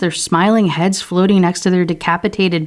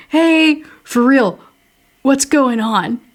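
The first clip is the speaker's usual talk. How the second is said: mocking Samantha voice; 7 semitones higher, slower than usual. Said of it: "she's like".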